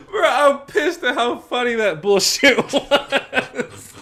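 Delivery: high, loud words breaking into real laughter; laughing helplessly. Real, not acted. Podcast host cracked up by co-host's joke, laughing hard. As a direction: talk through a big laugh, slowing down. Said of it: "I'm actually laughing".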